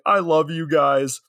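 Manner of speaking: high, hammed-up heartfelt speech; mock earnest declaration. Host acting out Mike before his shark death, hammed-up. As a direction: overact it, high and impassioned. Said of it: "And Mike is like".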